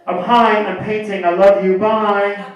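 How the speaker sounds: loud, singsong sign-off; imitated caller signing off loudly. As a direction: toss off a campy, drawn-out goodbye. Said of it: "My cousin calls me every week".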